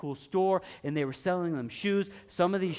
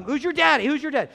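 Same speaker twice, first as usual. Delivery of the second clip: high, loud mock taunt; mock-confrontational call; taunting challenge; 9 semitones higher, 9 dB louder than usual. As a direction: fire the question at him loudly. Repeats the acted taunt.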